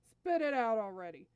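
loud, impatient snap; irritated prince ordering a minion; impatient demand. Acted. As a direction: cut in on the stalling, loudly. Impatient prince interrupts stalling minion.

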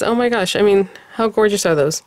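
normal talking voice

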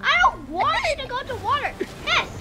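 high, loud, shrieked reactions; frantic excitement. Real, not acted. Player rooting for the shark in battle, frantic shrieks. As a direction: cry out high as the fight turns.

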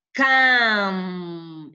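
CAM loud and long, held on the m; syllable drawn out. Shown as a demonstration. Teacher isolating the syllable to colour, drawn out.

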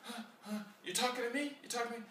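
a passer-by startled at being spoken to, high and surprised; startled stranger. Retold or imitated. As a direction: play a stranger caught off guard. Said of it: "You know, like".

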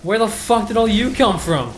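exasperated yell at the enemies; angry yell. Real, not acted. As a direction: yell it out, fed up. Swarmed by enemies, yelling.

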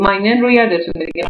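ordinary talk

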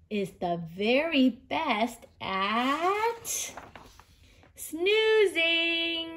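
slow narration held before the last word; suspenseful storybook reveal; drawn-out build-up. A narrator's reading. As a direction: stretch it out, then lift the final word.

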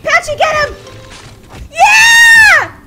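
high, loud cheering shout. Real, not acted. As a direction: cheer on the pet loudly. Cheering her pet in battle.

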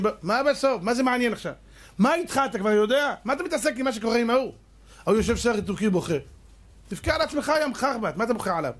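high mocking rant at a crying moviegoer; mock scolding. (נזיפה לועגת בקול גבוה)

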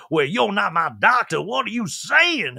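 mock-indignant voice, stretched at the end; Sutton. Sutton challenges Anna Marie at the party, mock-indignant impression. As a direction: imitate an affronted objection, drawing out the question. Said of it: "And Sutton's like".

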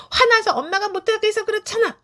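put-on high, loud angry child yelling "화나서"; angry child outburst. Shown as a demonstration. Child exploding at mother in anger, high and loud. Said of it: "화나서 막 말하는 건요".

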